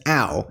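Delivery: "ow" as a glide, as in English out; diphthong ow. Shown as a demonstration. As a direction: open "ow" and round it off. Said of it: "as in the English out".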